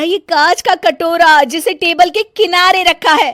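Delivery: high loud shouting for mother; yelling. Acted. Bahu yelling for her mother-in-law about the glass bowl, loud high voice. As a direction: shout the warning across the room.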